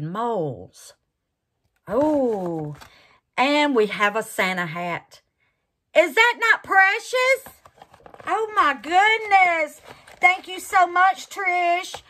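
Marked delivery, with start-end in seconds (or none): 6.0-12.0 high and loud, doting; thrilled thanks, much higher; gushing delight. Real, not acted. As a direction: gush over the gift. Gushing over the Santa hat mold.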